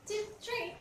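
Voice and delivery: ordinary talk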